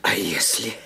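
a hoarse, voiceless whisper of dread. Acted. Nephew asks the lawyer in a hoarse, frightened whisper. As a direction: barely get the words out, throat tight with fear. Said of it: "Он услышал хриплый голос".

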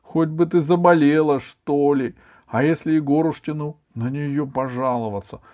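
put-on high, loud boy's grumble; exasperated schoolboy lament; anguished. A narrator's reading. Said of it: "с тоской подумал Иван".

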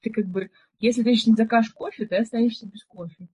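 ordinary delivery

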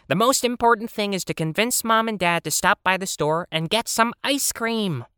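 scoffing penguin. Acted. Penguin pushes for ice cream, in a high insistent voice.

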